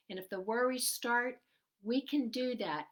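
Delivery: normal talking voice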